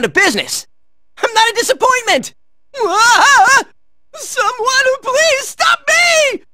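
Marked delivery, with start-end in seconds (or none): 2.8-3.6 loud, high wavering "aah"; panicked scream. Acted. Hero out of control, loud high scream. 4.1-6.4 slow, high, drawn-out shout; panicked plea. Acted. Hero begging someone to stop them, high panicked shout.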